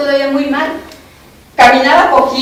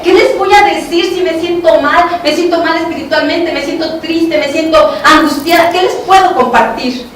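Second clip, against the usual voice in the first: louder pleading, rising in distress; a distressed cry of helplessness; anguished protest; 5 semitones higher, 5 dB louder than usual. Retold or imitated. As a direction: plead that you have nothing to give.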